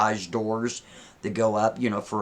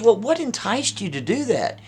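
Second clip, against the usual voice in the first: lightly voiced woman asking, pitched higher; higher mock woman's voice; 10 semitones higher than usual. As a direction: put on a higher, questioning woman's voice. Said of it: "She goes".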